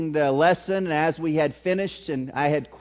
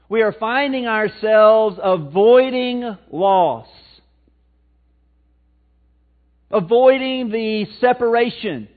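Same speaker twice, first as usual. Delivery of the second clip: slow, louder sermon emphasis; preaching; 4 semitones higher, 6 dB louder, slower than usual. Acted. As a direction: lean into each phrase like a preacher.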